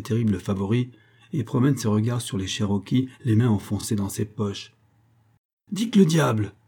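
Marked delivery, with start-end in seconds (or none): 5.7-6.5 high, excited cheer, moderately raised; acclaiming cry. A narrator's reading. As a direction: cry out the name in acclaim, pitched up. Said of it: "Tel fut le cri qui s'éleva".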